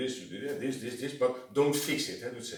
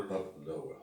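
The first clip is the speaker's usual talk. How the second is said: said lower and softer; lower pitch; 8 semitones lower, 8 dB quieter than usual. Shown as a demonstration. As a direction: drop the voice down. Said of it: "Not lower".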